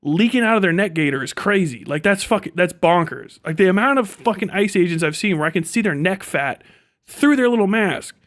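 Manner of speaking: ordinary delivery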